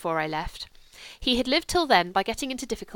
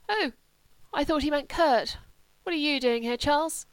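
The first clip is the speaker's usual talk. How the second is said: higher-pitched, weak invalid's voice; lightly voiced Sebastian; 7 semitones higher than usual. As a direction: lightly play a sick friend, pitched up.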